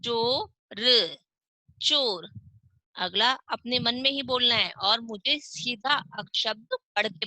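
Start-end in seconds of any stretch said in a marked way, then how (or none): 0.0-1.2 slow, stretched teacher reading; drawn out. Shown as a demonstration. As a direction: draw out each sound of चोर slowly. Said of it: "च पर ओ की मात्रा लगी तो क्या बनेगा?".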